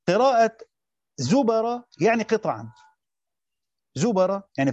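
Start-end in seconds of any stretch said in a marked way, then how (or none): 1.2-1.8 higher-pitched zubara, b with fatha. Shown as a demonstration. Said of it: "بفتح الباء". 3.9-4.4 zubara, b given a fatha. Shown as a demonstration. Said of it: "بفتح الباء".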